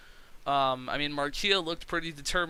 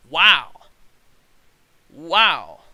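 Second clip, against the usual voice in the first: very loud, drawn real astonishment; stunned wow; 12 dB louder than usual. Real, not acted. Seeing the shocking match score, loud stunned wow. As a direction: blurt it out in disbelief.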